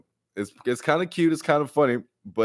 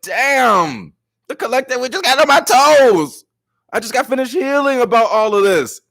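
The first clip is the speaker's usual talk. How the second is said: loud, much higher, fired-up; excited outburst; 12 semitones higher, 7 dB louder than usual. Real, not acted. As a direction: rant with full energy. Excited rant to viewers.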